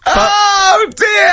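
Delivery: very high, laughter-filled exclamation; high cry of real amusement; helpless amusement. Real, not acted. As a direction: squeal it through helpless laughter.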